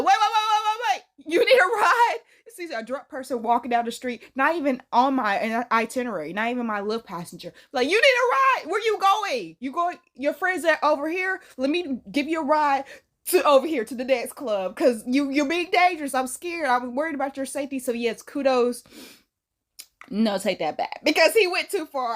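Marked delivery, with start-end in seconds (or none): 0.0-2.2 high, louder call to a stranger. Retold or imitated. Driver calling out to a drunk person on the street. 7.9-17.8 eager concerned calling. Retold or imitated. Calling out to drunk person.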